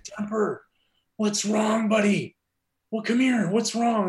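high-pitched imitation of a worried coach; concerned coach. Coach comforting his crying player.

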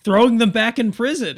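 high, loud indignant voice; whiny complaint. Acted. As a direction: protest shrilly, stammering at first. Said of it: "Henry's like".

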